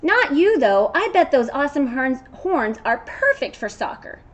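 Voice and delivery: loud and high, bursting with an idea; storybook goat full of enthusiasm; excited goat. Acted. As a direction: pitch the big team-up.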